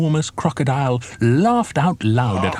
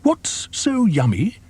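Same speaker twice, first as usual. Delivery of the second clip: cheerful hippo; 10 semitones higher, slower than usual. A narrator's reading. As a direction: call out a jolly hello. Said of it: "asked Humpy Rumpy".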